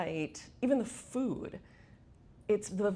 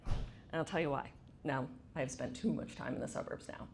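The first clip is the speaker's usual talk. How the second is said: ordinary talk, nothing marked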